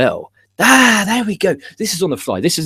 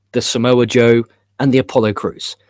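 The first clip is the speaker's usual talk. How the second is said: normal talking voice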